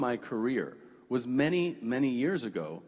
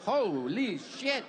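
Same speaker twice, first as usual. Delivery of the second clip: stunned; 7 semitones higher, much slower than usual. Pretend. Stunned reaction to Germans.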